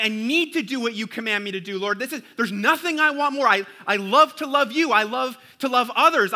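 high, loud, passionate prayer; eager devotion. Retold or imitated. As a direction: pour out a longing heart to the Lord. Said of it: "You'll be like".

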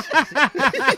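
high loud laugh; laughing burst. Real, not acted. Retelling the doorstep rejection, high loud laugh.

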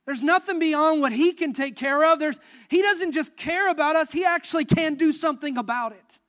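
loud and high, preaching with force; fervent preaching. Acted. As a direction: lift the room with it.

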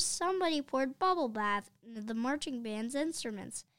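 plain reading voice

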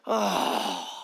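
low, unimpressed "Oh." with an eye-roll; dismissive oh. Retold or imitated. Seatmate on the plane brushing off a preacher, low and dismissive. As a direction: drop it low like a man who has just lost interest. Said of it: "he rolls his eyes and he goes".

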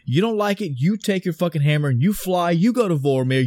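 heated retort. Retold or imitated. Clint firing back at Thor over the Soul Stone, exasperated. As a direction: fire it back at him, fed up.

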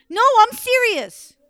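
high, loud, slow pleading; scared insistence. Acted. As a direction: insist in fright to Mom.

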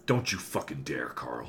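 low, soft menacing warning; quiet threat. Acted. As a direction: threaten him under your breath.

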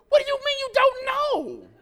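high, loud panicked cry; panicked wife. Retold or imitated. Wife panicking in the car, high and loud. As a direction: squeal it in a panic. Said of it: "she's all in a panic".

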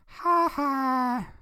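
high, drawn-out mocking ha-ha; Nelson. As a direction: taunt with a sing-song high laugh. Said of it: "doing his ha ha".

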